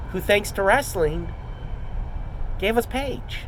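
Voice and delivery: ordinary talk, nothing marked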